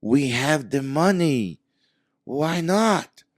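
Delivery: imitated frustration rising to a cry; frustrated plea. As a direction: drag it out, exasperated. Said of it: "really frustrated".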